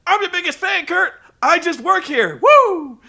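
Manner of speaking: high, loud, panicky begging with a laugh; hammed-up Woo at the end; Ric Flair impression. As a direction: squeal like a cowardly heel begging off.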